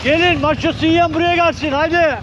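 high loud summoning shout; calling out loud. Real, not acted. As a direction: call them over from afar. Calling people over to him. (yüksek sesle çağırma)

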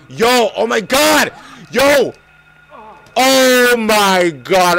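high, loud, drawn-out disbelief; stretched-out cries of shock; shocked yelling. Real, not acted. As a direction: wail it slowly at the screen. Dies in the game.

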